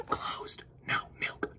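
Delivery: secretive whisper; terse whispered notice. Acted. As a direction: breathe it like a conspirator. Cows' terse strike note.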